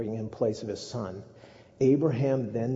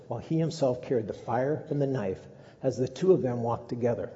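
ordinary delivery

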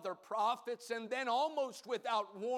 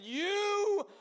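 slow, higher ringing proclamation; Peter declaring; 8 semitones higher, 5 dB louder, much slower than usual. Acted. Simon Peter declaring to Jesus, loud and slow. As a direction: cry it out, stretched and high. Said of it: "piped up".

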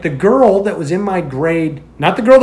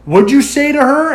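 retold drunk challenge; 9 semitones higher than usual. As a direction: confront him like a drunk bully. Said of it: "he's like".

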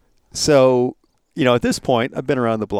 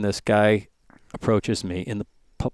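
ordinary talk, nothing marked

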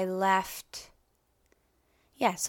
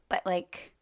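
ordinary delivery

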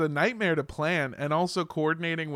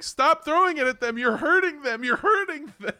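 high, loud mimicked protest; mock yelling; 12 semitones higher, 5 dB louder than usual. Coworker yelling at the merch throwers from behind, high mock yell. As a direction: imitate a frantic coworker yelling, much higher and louder. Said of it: "yelling at us".

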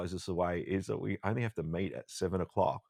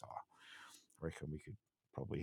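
whispering; 3 semitones lower, 16 dB quieter than usual. Retold or imitated. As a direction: whisper it like an old pilot. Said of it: "And he goes".

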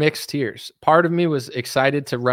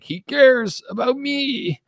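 high, drawn-out gushing; syrupy sarcastic imitation of a believer; mock adoring fan; 11 semitones higher, much slower than usual. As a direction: swoon slowly in a put-on high voice. Mimics adoring Trump supporters.

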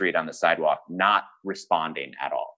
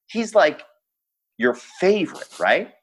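friend Daniel; 14 semitones higher than usual. Retold or imitated. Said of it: "And Daniel goes".

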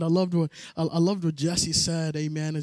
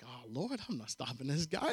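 scared; 6 semitones lower, 11 dB quieter than usual. Retold or imitated. Afraid of the huge man.

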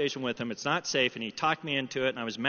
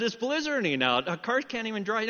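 higher-pitched retold objection; protesting dad; 6 semitones higher than usual. Dad objecting to son's request.

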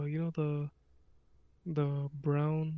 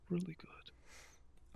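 whispering; 2 semitones higher, 10 dB quieter, much slower than usual. Acted. Whispered punchline after "Damn.

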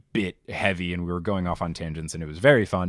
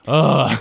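exasperated groan; 5 semitones higher, 10 dB louder than usual. Real, not acted. Exasperated at hedging talk.

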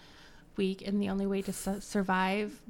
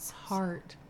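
ordinary talk, nothing marked

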